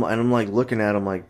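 ordinary talk

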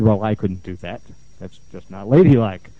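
low-pitched, demure refusal; reluctant prim client. Retold or imitated. Client refusing to hit pillow, prim low refusal.

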